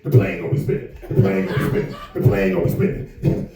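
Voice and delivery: Lavelle Crawford impression. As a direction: drop way down into a big deep voice. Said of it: "This is Lavelle Crawford".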